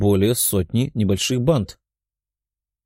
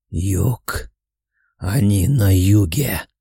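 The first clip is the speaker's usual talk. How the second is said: urgent demon voice; much slower than usual. A narrator's reading. Demon senses the kidnappers.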